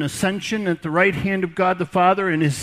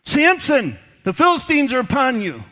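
loud, high cry of alarm; cries out; 6 semitones higher, 4 dB louder than usual. Retold or imitated. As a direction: shout the warning at him. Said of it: "she cries out".

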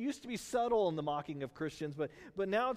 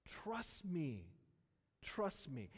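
much softer, tender repetition; quiet, almost pleading; gentle plea; 2 semitones lower, 8 dB quieter, much slower than usual. Retold or imitated. God to Alan, softly pleading.

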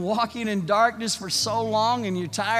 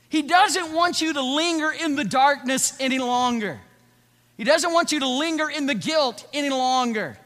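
higher, louder insistent preaching; fervent preaching; 7 semitones higher, 7 dB louder than usual. Acted. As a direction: press the point with conviction. Preaching at full force.

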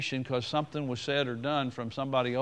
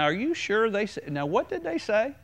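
high, probing questions; questioning pastor; 7 semitones higher than usual. Retold or imitated. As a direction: press them with pointed questions, pitched high. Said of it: "when I question it now".

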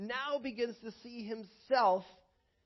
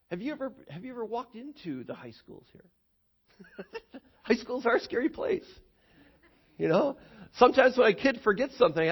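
normal talking voice

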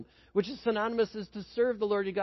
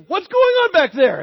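much higher, loud call to the back seat; uncle calling back; 11 semitones higher, 12 dB louder than usual. Retold or imitated. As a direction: shout it over your shoulder from the driver's seat. Uncle driving, calls back.

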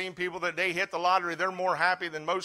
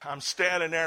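ordinary talk, nothing marked

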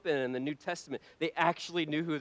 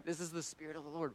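ordinary delivery

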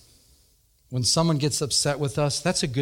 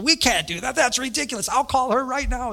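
high-pitched imitation of an outraged secretary; mock indignant yelling; 9 semitones higher than usual. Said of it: "She started yelling".

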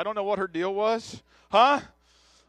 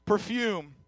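scripture reading; 4 semitones higher than usual. Acted. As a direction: lift the words up as holy text. Reads Paul's letter aloud.

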